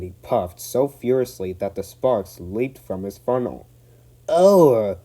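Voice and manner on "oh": high, loud pained groan; van groan. Acted. The van squeezed between engines, groaning. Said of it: "groaned the van".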